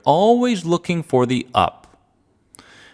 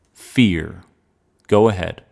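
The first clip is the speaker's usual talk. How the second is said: calm grounding exercise. Acted. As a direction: calmly guide each feeling, pausing long. Guides a feeling exercise.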